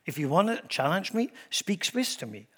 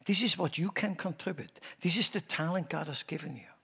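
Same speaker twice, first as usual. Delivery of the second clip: normal talking voice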